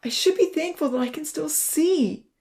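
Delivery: high, loud, anguished self-reproach; upset and pleading, pitched high; tearful distress. A narrator's reading. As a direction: berate yourself on the edge of tears. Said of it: "he broke down crying again".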